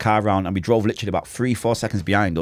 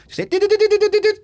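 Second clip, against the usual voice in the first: rapid high did-did-did like shots going off; a rapid run of high mock gunfire; 5 dB louder, faster than usual. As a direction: rattle off the gunshots high and quick. Imitating gunshots in a story.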